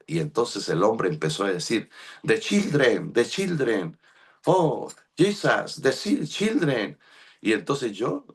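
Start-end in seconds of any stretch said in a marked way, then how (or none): 2.2-7.0 rising, slow pleading in English; lightly voiced stranger crying out slowly; imitating a pleading stranger. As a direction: mimic a street man begging, high and drawn out. Man on the street pleading.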